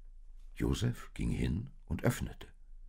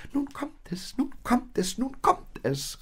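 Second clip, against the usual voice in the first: much higher, excited little animal voices; little ones calling; 4 dB louder than usual. Acted. The little lambs and goats cry out in alarm, much higher. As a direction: chant it out in alarm like tiny lambs. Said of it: "riefen die Kleinen".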